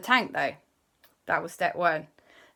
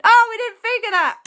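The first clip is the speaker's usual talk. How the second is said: much higher, louder sheepish surprise; surprised realisation; 10 dB louder than usual. Acted. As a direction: everyone caught out together. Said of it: "everyone went".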